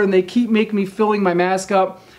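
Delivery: usual speaking voice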